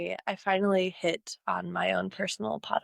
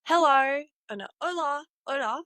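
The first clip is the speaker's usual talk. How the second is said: higher, Spanish words in a British accent; joking demo of a Brit speaking Spanish, pitched higher; 6 semitones higher than usual. Shown as a demonstration. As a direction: greet in Spanish the way a Brit would say it. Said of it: "a British accent in Spanish".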